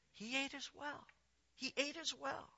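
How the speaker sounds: quiet, pointed repetition for effect; hushed disclosure. Real, not acted. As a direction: let it land in a near-hush. Grave reveal, repeated for effect.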